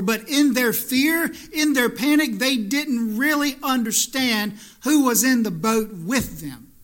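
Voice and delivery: loud, high sermon climax; preaching at full force. Acted. Preacher telling friends about the disciples' panic, full force. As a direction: drive the point home to the church.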